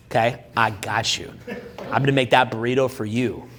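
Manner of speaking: low-pitched, unhurried humble brag; deadpan fast-food worker. Shown as a demonstration. Man tells woman about his Taco Bell job, low deadpan.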